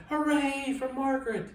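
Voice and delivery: high, loud joyful cheer; cheering shout. A narrator's reading. Pupils cheering Margaret for kicking the snake out, high joyful cheer. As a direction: cheer her like a happy crowd of kids. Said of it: "All the boys and girls shouted".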